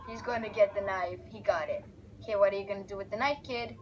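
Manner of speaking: usual speaking voice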